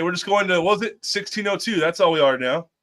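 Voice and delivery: ordinary delivery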